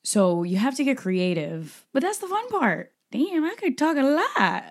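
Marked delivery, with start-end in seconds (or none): 1.9-4.6 amused at himself. Real, not acted. Catches himself talking too much.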